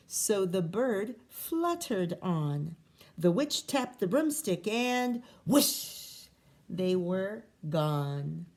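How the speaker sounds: plain reading voice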